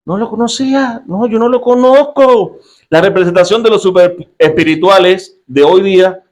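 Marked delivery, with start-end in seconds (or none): 0.0-2.6 Pedro negando. A narrator's reading. Pedro vuelve a negar.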